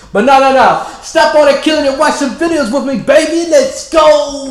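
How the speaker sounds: high, loud playful hype; hyped up. Real, not acted. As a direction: pump up the viewers. Hyped host, higher and louder.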